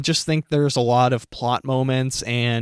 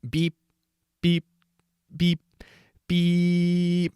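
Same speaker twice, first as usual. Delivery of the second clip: short even beeps, then one long higher tone; flatline monitor beeps; 6 semitones higher, much slower than usual. Acted. Reading a joke review of a dead film, monitor beeping. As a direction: imitate a heart monitor flatlining.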